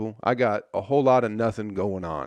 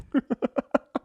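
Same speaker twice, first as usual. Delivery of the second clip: high laugh. Real, not acted. Laughing at own impression.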